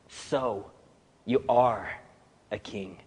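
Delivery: hushed, uneasy dawning recognition; surprised and uneasy. Shown as a demonstration. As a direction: let it sink in, half to himself. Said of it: "with a bit of surprise and a lot of uneasiness".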